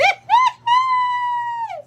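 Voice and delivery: a sudden high shriek of real laughter. Real, not acted. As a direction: burst into a loud, high squealing laugh. Bursts out laughing at comment.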